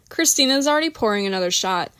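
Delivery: ordinary delivery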